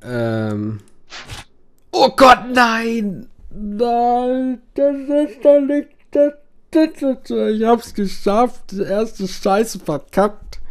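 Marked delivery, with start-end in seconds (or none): 1.9-10.6 very high, loud, drawn-out cries of panic; real panicked shriek. Real, not acted. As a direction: shriek in real dismay as the quest fails. Panic over failing quest. (hoher, lauter, panischer Aufschrei)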